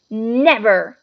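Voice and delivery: loud defiant cry; boy refusing the villain loudly; defiant shout. A narrator's reading. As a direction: throw the refusal back at him. Harry defies Voldemort.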